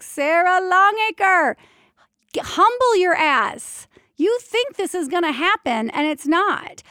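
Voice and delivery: loud, high, drawn-out telling-off; mock divine scolding. God humbling the doula after a slap, scolding. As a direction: put her in her place, playfully stern.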